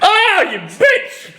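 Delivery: very high, drawn-out indignant cry; slow, high, hammed-up indignation; mock outrage. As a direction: screech it in playful offence. Mock outrage at co-host's jab.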